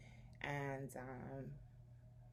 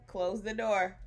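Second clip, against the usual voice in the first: loud, higher firm order; firm command; 4 semitones higher, 9 dB louder, slower than usual. Real, not acted. Sending her intruding child out.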